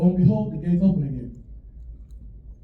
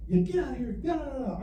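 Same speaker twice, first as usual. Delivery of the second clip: high, restrained imitation of grandma yelling; mock yell; 7 semitones higher, 6 dB quieter than usual. Grandma runs out to chase the dog off, mock yelling. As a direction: play a mock yell, pitched up without full volume.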